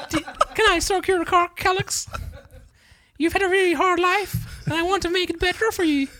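very high mock Swedish voice, half laughing; high Swedish accent. Swedish girl consoling him over his hard life, high Swedish accent. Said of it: "It's just the high voice".

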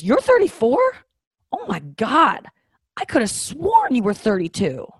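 high, loud, hammed-up shock; swooping, exaggerated disbelief; mock astonishment. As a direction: imitate someone utterly floored by the news. Acts out football player's astonishment.

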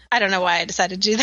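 normal talking voice

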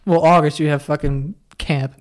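normal talking voice

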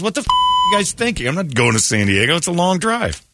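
hammed-up outrage; mock indignant. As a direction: scold the producers. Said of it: "looked at the producer and goes".

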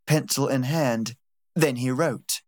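ordinary delivery, nothing marked

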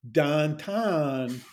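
"Don Ton" for downtown, flat monophthong vowels; Pittsburgh pronunciation. Shown as a demonstration. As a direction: say "Don Ton" slowly, one long 'ah' each. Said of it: "Well, we say".

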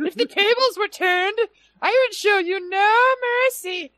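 very high squeaky tiny-guy voice; high-pitched voice. Retold or imitated. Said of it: "this one already has a high-pitched voice".

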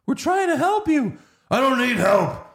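whiny fake Batman. The fake Batman whining at Batman, squeaky high and loud. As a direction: squeal it like an indignant fan.

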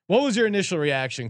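ordinary talk, nothing marked

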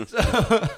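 loud high burst of laughter. Real, not acted. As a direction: crack up laughing. Laughing at own story.

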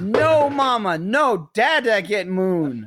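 put-on high, insistent little-kid refusal; imitating toddler daughter. Toddler refusing mom's help, wants dad, high and insistent. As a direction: refuse mama like a stubborn toddler. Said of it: "And she goes".

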